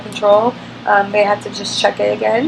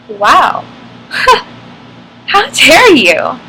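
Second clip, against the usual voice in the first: offended disbelief; 5 semitones higher, 7 dB louder, much slower than usual. Retold or imitated. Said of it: "I was just like".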